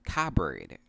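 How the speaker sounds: "cupboard" as KUH-berd, silent p; correct pronunciation. Shown as a demonstration. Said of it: "so it is pronounced".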